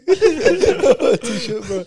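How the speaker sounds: high, loud laughing punchline. Real, not acted. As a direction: crack up on the last word. Punchline of his beach story.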